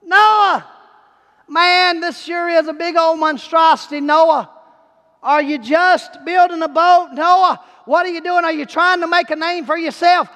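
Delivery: jeering heckler shouting his name; heckler hollering. Retold or imitated. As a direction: holler up at Noah to taunt him. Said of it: "He hollers".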